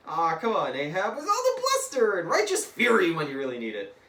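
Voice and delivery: high, hammed-up exasperated shout; loud goading. Deadpool mocks Ahab begging for mercy, loud and goading. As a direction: goad him at full volume.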